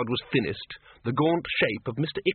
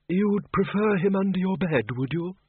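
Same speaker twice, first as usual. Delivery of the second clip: murmur; 7 semitones higher than usual. A narrator's reading. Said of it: "murmured Windle".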